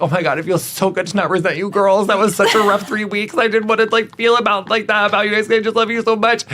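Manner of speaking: high, gushing relieved voice; mock relief. To her products, a high, gushing relieved voice. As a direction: gush it out like a relieved friend. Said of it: "I was like".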